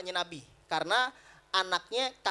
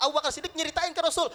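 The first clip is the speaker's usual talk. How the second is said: normal talking voice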